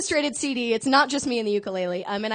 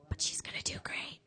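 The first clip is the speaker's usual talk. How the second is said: whispering; 7 semitones lower, 13 dB quieter than usual. Real, not acted. Quiet aside while song starts.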